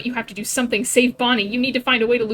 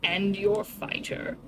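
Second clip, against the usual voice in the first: slow, hushed, whispery villain threat; a cruel king breathing threats close to the ear; menacing king; much slower than usual. Acted.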